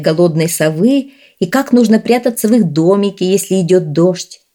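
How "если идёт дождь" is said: usual speaking voice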